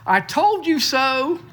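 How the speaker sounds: high, slow teasing I-told-you-so; mock-smug told-you-so; joking gloat. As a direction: rub it in playfully, drawing it out. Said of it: "I said that in jest".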